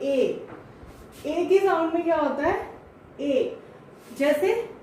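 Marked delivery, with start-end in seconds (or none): none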